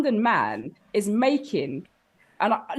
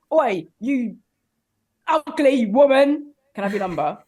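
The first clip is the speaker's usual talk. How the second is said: mock London bloke; 4 dB louder than usual. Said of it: "it's really like".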